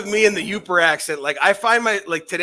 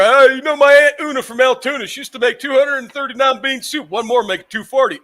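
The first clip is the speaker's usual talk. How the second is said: higher and louder Pittsburgh joke voice, lightly imitated; Pittsburgh accent; 8 semitones higher, 6 dB louder than usual. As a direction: ham up a Pittsburgh guy telling a joke. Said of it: "he always used to make jokes where".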